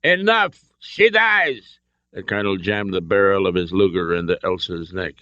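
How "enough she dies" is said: very high, forceful ultimatum; menacing shout. A narrator's reading. Colonel ends the argument at gunpoint, menacing shout. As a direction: cut everyone off with a threat. Colonel's final threat to shoot hostage.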